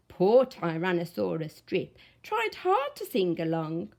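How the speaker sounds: plain reading voice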